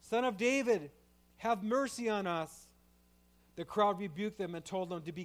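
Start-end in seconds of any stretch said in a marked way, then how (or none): 0.0-2.7 loud, high pleading cry; desperate shout. Acted. Blind men shouting to Jesus by the roadside, desperate loud cry. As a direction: call out to Jesus with everything. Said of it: "they shouted".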